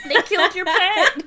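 high, loud laughing outrage; laughing disbelief. Real, not acted. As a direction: squeal it through a laugh. Shocked at the Furby story.

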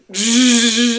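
loud, high buzzing saw imitation; long vocal buzz of a power cutter; imitated saw noise. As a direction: mimic a super loud cutting sound. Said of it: "like, the super loud sound".

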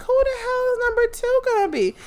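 dying to know; high excited mock question; eager wonder. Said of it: "I'm like".

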